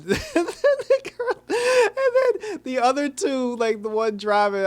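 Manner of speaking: high squeaky laugh while talking; words squeezed out through a laugh fit; giggling so hard it breaks the words. Real, not acted. Cracking up mid-story.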